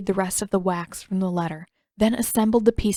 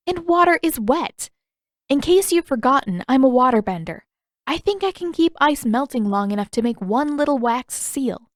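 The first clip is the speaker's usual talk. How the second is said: higher, louder, exasperated retort; Katara, indignant; 6 semitones higher, 4 dB louder than usual. Acted. Katara snapping back at Zuko, exasperated and louder. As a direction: tell him off with sharp impatience.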